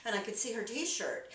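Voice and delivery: ordinary talk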